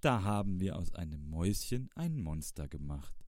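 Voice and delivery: ordinary delivery, nothing marked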